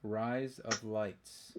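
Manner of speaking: drawn-out, very slow delivery; deliberately slow. Pretend. Asked to say it five times fast, deliberately slow. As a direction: stretch each word right out.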